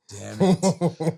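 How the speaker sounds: sharp, high-pitched exasperated curse; retelling a cop's annoyed moment, sharp exasperated curse; frustrated curse. Retold or imitated. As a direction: spit it out, annoyed at being stuck with this. Caught rolling stop sign, frustrated.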